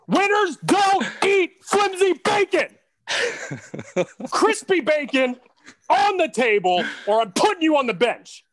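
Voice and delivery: very high, loud, slow hammed-up yell with laughs; mock coach shout. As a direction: bark bacon orders like a sideline tirade. Coach yells bacon orders.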